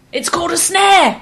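high, triumphant exclamation; excited reveal. Retold or imitated. Story climax, trap revealed aloud.

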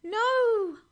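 very high, loud cry of protest; piercingly high, drawn-out shout; high-pitched cry. A narrator's reading. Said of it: "there was a high-pitched cry".

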